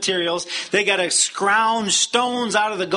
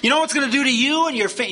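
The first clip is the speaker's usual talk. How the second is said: rabble-rousing tyrant; 9 semitones higher than usual. Acted. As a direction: whip a crowd into fury with mocking questions. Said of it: "this is the kind of thing he's doing".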